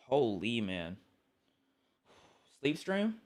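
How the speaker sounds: usual speaking voice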